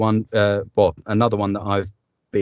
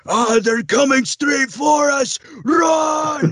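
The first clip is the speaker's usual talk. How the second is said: high panicked shout, much louder; battle game shout; 4 dB louder, slower than usual. Shown as a demonstration. Voice actor demos a battle game line, panicked shout. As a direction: yell a warning as the enemy charges. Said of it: "I've done some work on sort of battle games".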